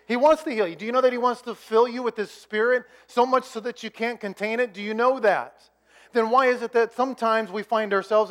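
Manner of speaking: loud, high, driving sermon delivery; preaching at full force. Acted. Pastor urging the congregation, preaching at full force. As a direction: preach it out hard to the whole room.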